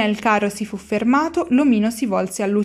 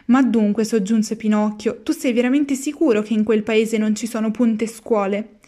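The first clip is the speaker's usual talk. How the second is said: ordinary delivery, nothing marked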